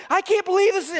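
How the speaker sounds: hammed-up tense wife; wife's disbelief. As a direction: play the wife caught up in the show. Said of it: "And my wife's like".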